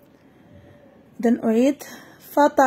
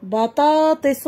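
بطاطس stretched slowly syllable by syllable; slow classroom model of بطاطس; slow model reading; slower than usual. Shown as a demonstration. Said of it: "إذن المقطع الثاني والثالث".

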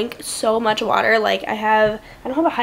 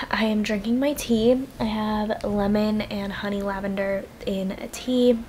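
ordinary delivery

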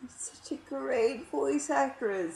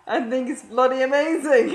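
laughing, loud mock British accent; bad British accent; 5 semitones higher, 8 dB louder than usual. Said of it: "my bad British accent".

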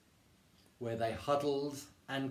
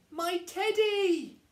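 drawn-out, very high, loud wail; loud cry; 6 dB louder, slower than usual. Acted. Eddie spots his own teddy, crying out. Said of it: "cried Eddie".

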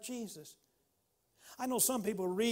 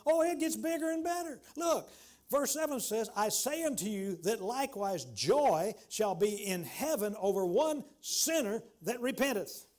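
rising sermon fervor; 3 semitones higher, 4 dB louder than usual. Real, not acted. Preacher building again, louder.

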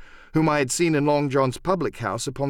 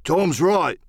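higher-pitched grumbling crewman; murmuring pirate; 6 semitones higher, 4 dB louder than usual. Acted. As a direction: back your mate as a rough sailor. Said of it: "a hoarse murmur rose from the others".

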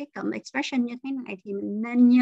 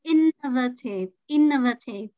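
innovative as IN-nuh-vuh-tive; innovative said carefully, first syllable stressed. Shown as a demonstration. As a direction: model innovative, punching the opening IN. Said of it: "Nhấn âm một".

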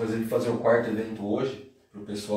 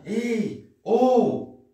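high hailing call, louder on the last word; attention-getting call; 11 semitones higher, 5 dB louder than usual. Shown as a demonstration. Teacher giving Portuguese equivalents, high calling out. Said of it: "pra chamar a atenção da pessoa".

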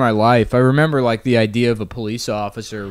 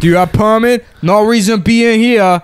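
put-on higher, louder gruff Italian-American accent; 10 semitones higher, 6 dB louder than usual. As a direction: shoo him out in a mob Italian accent. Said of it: "that Italian accent".

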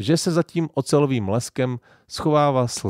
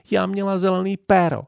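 crying; 9 semitones higher, 4 dB louder than usual. A narrator's reading. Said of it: "plakala maminka".

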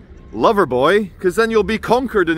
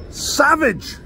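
mock exclamation; 9 semitones higher than usual. Mock self-verdict exclamation.

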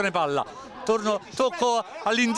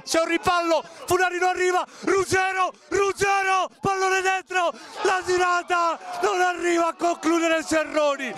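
excited shout; 6 semitones higher than usual. Real, not acted. Scramble in the box.

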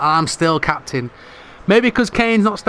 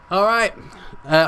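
normal talking voice

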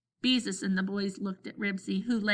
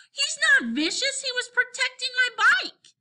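high, loud, insistent protest; growing excited; 5 dB louder than usual. A narrator's reading. Henry defends Ribsy to Scooter, excited protest. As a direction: defend the dog at a high pitch. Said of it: "Henry was growing more excited".

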